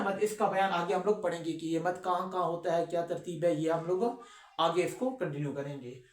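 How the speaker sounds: normal talking voice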